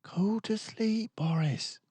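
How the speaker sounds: a hint of tired parents; parents' voice. A narrator's reading. Parents hushing Boris, lightly voiced. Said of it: "Mama and Papa whispered".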